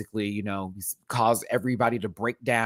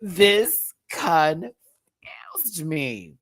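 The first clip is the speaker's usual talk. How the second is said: drawn-out mock confusion; 6 semitones higher, 4 dB louder, much slower than usual. Real, not acted. Drawn out joke about confusion.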